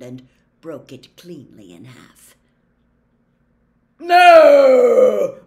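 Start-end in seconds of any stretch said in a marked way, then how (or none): 4.0-5.4 huge drawn-out roar, high and very loud. A narrator's reading. Centaur roars into Harry's ear, full-volume roar. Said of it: "roared a voice in Harry's ear".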